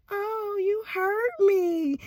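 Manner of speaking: high, slow, drawn-out wounded whine; hammed-up tender complaint; mock hurt. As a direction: stretch it out high like a wounded softie. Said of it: "instead of saying".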